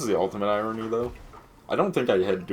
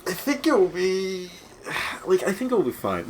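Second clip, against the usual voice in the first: high silly voice; 11 semitones higher than usual. Pretend. High pitched put-on voice.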